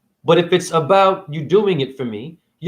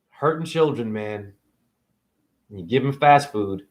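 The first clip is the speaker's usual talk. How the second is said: ordinary talk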